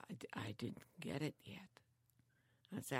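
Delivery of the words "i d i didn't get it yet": imitating Bob in a whisper. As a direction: hush it out like a mumbling old man.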